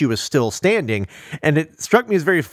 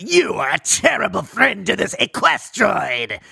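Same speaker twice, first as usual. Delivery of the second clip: accusing horse god; 6 semitones higher, slower than usual. Retold or imitated. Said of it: "he's really like".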